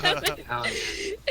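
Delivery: breathy laughing curse under the breath; unvoiced laughing damn it; laughing whisper. Real, not acted. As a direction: giggle it out with no voice. Ice cream melting, laughing.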